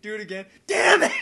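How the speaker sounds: normal talking voice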